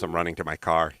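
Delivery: ordinary talk, nothing marked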